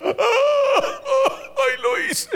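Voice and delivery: high laughing ay, I did it; shrill giggling admission; laughing confession. Acted.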